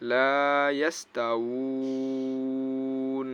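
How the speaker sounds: লা ইয়াস তা উ ন with a very long held uu closing on n; chanted recitation, last vowel উ drawn right out before ন; waqf stop, three-alif stretch. Shown as a demonstration. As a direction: stretch the উ at length, then stop on a bare ন. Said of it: "এখানে ওয়াকফ করলে হবে কি?".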